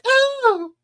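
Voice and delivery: high, loud mock-terrified oh; startled gasp. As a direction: yelp like you've been caught. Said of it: "the stepdaughter's like".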